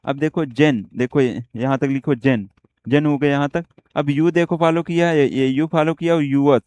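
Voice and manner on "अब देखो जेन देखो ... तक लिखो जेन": normal talking voice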